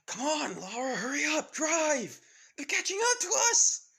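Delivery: put-on high, loud panicked boy urging her on; frantic high shouts from the back seat; panicked yelling. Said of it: "With the guy saying".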